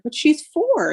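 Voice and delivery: high-pitched retold protest; incredulous objection. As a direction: object to the doctor in disbelief. Retelling her objection to doctor.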